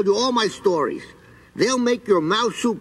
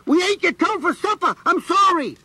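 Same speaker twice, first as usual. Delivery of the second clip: much higher small confessing voice; little mouse voice; 11 semitones higher than usual. Acted. Frightened mouse confesses to the creature, small and high. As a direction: own up timidly as the mouse.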